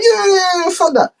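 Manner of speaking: very high, frantic yelling; cartoonish high-pitched tantrum; mock Trump. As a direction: squeal orders in a panic. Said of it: "Trump is like".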